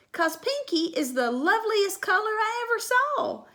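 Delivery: high, eager, bubbling over; girl gushing to dad. Acted. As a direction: gush about it. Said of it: "Junie gushing about name".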